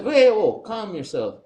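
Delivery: high start dropping to firmer calm-down; retold warning. Customer telling an angry clerk to back off, retold warning.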